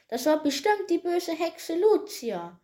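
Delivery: high little dwarf voice; bright-voiced forest dwarf. A narrator's reading. Dwarf names the culprit to Peter in a high bright voice. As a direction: pitch it up for the small bright dwarf. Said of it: "eine helle Stimme hörte".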